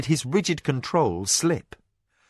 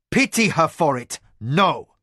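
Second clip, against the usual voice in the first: brutal; 4 semitones higher, 4 dB louder than usual. A narrator's reading. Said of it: "Arakasi said brutally".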